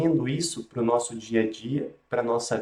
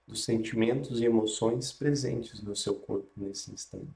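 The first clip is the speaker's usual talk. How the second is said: guided meditation; 3 dB quieter than usual. Acted.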